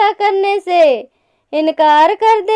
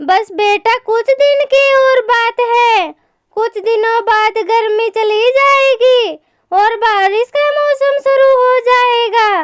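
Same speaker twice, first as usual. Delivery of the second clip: higher-pitched storybook mother; reassuring mother bird; 6 semitones higher than usual. Acted. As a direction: reassure your chick in a raised character voice. (दिलासा देती माँ चिड़िया की ऊँची आवाज़)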